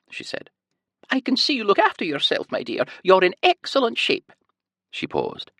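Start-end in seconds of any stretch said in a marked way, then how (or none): 1.1-4.3 high sing-song Scottish lilt; sweet high nurse. A narrator's reading. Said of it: "a heavy Scottish accent and a high-pitched sing-song voice".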